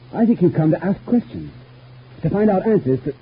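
high-pitched, pointed accusation; accusing. Acted. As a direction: accuse him of prying. Accuses visitor of prying.

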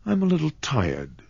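put-on high, a touch wistful young girl; dreamy, tired of riches. A narrator's reading. Kismine holding the rhinestones, wistful. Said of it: "fingered the brilliants wistfully".

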